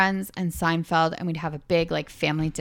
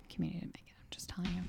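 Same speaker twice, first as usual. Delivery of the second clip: soft conspiratorial whisper; 17 dB quieter, much faster than usual. Real, not acted. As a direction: whisper it like a secret. Whispered confession about the ranking.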